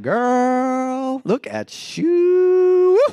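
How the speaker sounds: high, drawn-out charmer's coo; flirty admirer.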